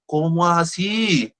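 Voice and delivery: slow, high, stretched-out disbelief; mock astonishment. Counselor reacting to patient's sudden recovery, drawn-out mock surprise.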